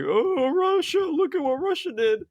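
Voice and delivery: very high falsetto outcry; hammed-up shrieky accusation in a silly high voice; mock yell. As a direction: imitate a panicked tattletale yelling way up high. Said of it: "yelled out like".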